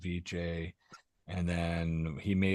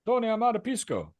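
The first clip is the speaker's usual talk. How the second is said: mock neighbor plea; 12 semitones higher than usual. Teller imagines Eugene asking.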